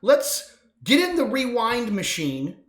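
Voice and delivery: ordinary talk, nothing marked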